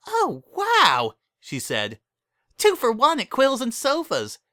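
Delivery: high, loud, delighted gush; thrilled. Acted. Pinkie reading the sale in the paper, thrilled. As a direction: gasp it out, overjoyed at a bargain.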